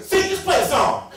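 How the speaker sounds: loud, high interrupting order; impatient shout. Retold or imitated. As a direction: cut in with a loud demand. Interrupts his story, ordering him on.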